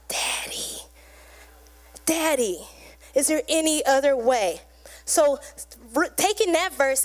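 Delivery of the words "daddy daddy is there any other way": hushed plea to Daddy, easing into voice; pleading cry. Acted. Jesus in agony crying out to his Father, hushed plea. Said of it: "He cried out".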